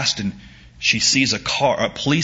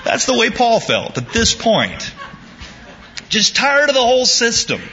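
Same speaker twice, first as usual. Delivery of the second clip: laughing through it; 12 semitones higher than usual. Real, not acted. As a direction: gasp the words out between laughs. Preacher laughing at own joke.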